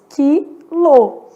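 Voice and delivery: "Quilo" split into two syllables, silent u. Shown as a demonstration. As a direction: sound out "Quilo" as ki, lo. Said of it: "olha o que acontece".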